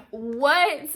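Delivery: outraged shout of disbelief; exploding what. Retold or imitated. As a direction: let the second what burst out. Host says it was a misunderstanding.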